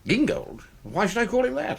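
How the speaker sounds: rising, baffled disbelief at the name; puzzled surprise. Acted. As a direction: act honestly confused about being corrected. Puzzled at being corrected.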